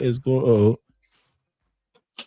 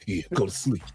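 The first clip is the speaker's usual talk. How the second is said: sleepy dismissive voice; 6 semitones lower, 9 dB quieter than usual. Retold or imitated. Retelling a movie scene.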